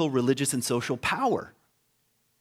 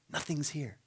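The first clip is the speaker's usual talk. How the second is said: breathy whisper, barely voiced; 2 semitones higher, 11 dB quieter than usual. Pilgrims inside the empty tomb, whispered. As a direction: let it out like a secret in a hushed tomb.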